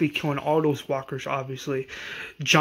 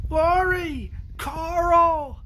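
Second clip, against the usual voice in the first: high, desperate scream of the names; father yelling for his family at the camp; 14 semitones higher, much slower than usual. Retold or imitated. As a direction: call out for wife and son in panic, pitched way up. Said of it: "Rick screams".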